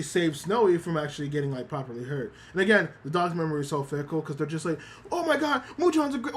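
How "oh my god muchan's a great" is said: high gushing dog voice. Retold or imitated. Dogs gushing over Muchan, high and excited. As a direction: gush like an excited little dog, pitched up.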